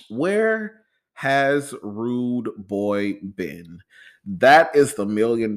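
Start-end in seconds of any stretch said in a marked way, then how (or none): 0.1-3.8 stretched out very slowly, rising at the end; dramatic slow question. Acted. Host teasing listeners with the big question, dramatic and slow. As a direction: drag it out for suspense.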